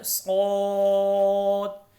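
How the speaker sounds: सौत with heavy s and long rounded o; thick, lengthened. Shown as a demonstration. Teacher shows learners a heavy letter, सौत stretched full. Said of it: "सौत को पुर पढ़ना है".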